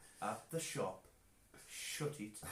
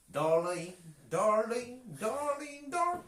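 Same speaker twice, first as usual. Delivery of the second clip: climbing, very loud mock Southern belle call; exaggerated Southern twang, rising to a squeal; Southern accent; 12 semitones higher, 12 dB louder, slower than usual. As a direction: call it higher each time, in a hammed-up Southern accent. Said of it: "[name]'s Southern".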